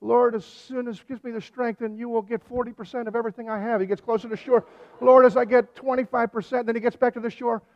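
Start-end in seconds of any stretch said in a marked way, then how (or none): 0.0-3.8 high, raised mock plea; desperate bargaining. Acted bargaining prayer nearing shore. 5.0-6.7 loud, high mock haggling; urgent bargaining. Acted bargain, louder as shore nears.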